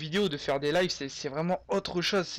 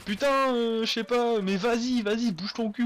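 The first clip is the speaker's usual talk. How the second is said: excited viewer comment; 7 semitones higher, 4 dB louder than usual. Pretend. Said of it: "vous pourrez mettre".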